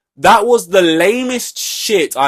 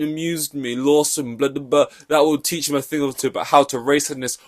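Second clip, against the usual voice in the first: usual speaking voice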